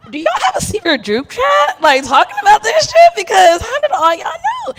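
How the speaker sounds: high, loud, laughing accusation; laughing outrage. Retold or imitated. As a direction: shriek it through laughter. Accusing family of secret chat.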